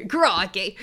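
hammed-up Aussie exclamation; a fake Aussie cry, played for laughs; mock Australian accent. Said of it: "I'm turning Australian".

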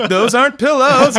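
very high, loud mock-horrified shout; horrified yell. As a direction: yell it in comic alarm. Horrified realization in bed scene.

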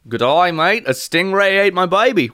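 high mock-Australian voice; hammed-up Australian accent; bad Australian accent. Telling a mate about the baby, bad Australian accent. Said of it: "Australians 'cause I can't do a good accent".

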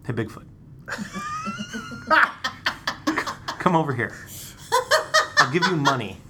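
catcall Bigfoot like a creep; creepy cat call. Pretend. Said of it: "sounds like a creepy cat call".

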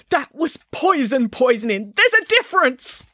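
slow, high-pitched furious cook; quivering fury. A narrator's reading. Cook furiously corrects the student about poisoning, quivering fury. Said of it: "quivered with fury".

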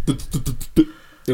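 mock stutter. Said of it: "He would go".

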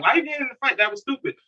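scolding mom. Retold or imitated. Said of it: "My mom like".

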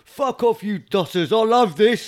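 high mock yell while dangled; shouting. Man dangled off a rooftop yelling at cops, shouting. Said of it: "He goes".

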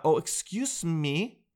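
usual speaking voice

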